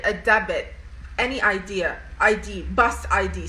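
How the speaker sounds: demanding guards. Retold or imitated. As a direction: bark orders at the sister. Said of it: "they'd be like".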